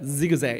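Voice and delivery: 지그재그 voiced as z-ig-z-ag; zigzag with English Z. Shown as a demonstration. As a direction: buzz the ㅈ in 지그재그. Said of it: "이렇게 얘기하는 거야".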